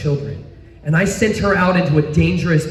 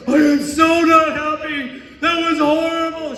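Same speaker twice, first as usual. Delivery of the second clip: upset wife; 8 semitones higher than usual. Retold or imitated. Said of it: "And she's like".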